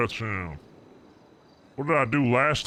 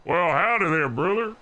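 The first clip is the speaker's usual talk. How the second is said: trucker voice; 6 semitones higher than usual. Pretend. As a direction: hail your brother like a good-ol' trucker. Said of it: "A little bit more in the chest".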